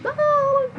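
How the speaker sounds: a sudden, high, showy "ah!"; exaggerated "ah". Shown as a demonstration. Teacher shows the expansive gesture the melancholic dislikes, with a high showy "ah". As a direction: throw out a big high "ah" like flailing arms. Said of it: "mexer muito os braços".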